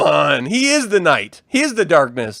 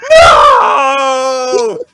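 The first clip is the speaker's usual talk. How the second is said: loud laughing mock scream; Vader's no scream; 5 semitones higher, 9 dB louder than usual. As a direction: wail the famous no, cracking up. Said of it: "He just does the no scream".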